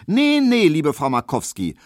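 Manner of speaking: high, loud cutting-in no; interrupting loudly. A narrator's reading. Maid interrupting her mistress with a high, loud protest. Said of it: "unterbrach Hedwig".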